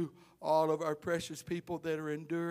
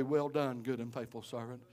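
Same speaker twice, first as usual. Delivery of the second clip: gentle blessing; 6 semitones lower, 6 dB quieter than usual. Acted. Preacher telling the kitchen cook the Lord's praise, soft and tender. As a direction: lower and much softer, tender. Lord's words to Sister Dallas.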